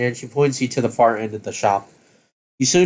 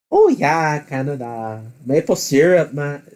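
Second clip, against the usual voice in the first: higher, hammed-up Canadian accent; 3 semitones higher than usual. Shown as a demonstration. Player trying out the only Canadian accent they know, clumsy Canadian accent. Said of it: "only thing I can think of as a Canadian accent".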